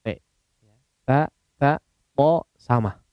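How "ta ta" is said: "Ta, ta" said with tongue tip on the gum ridge; demonstrating Arabic ta. Shown as a demonstration. Teacher contrasting letters for students, crisp ta. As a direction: model the ta sound crisply. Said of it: "untuk mengucapkan huruf T".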